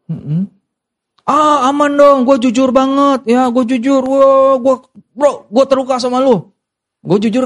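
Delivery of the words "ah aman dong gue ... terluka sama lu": hammed-up smug honesty; mock self-righteous friend. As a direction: parody a churchgoer bragging he's honest. Mocking a self-righteous churchgoer.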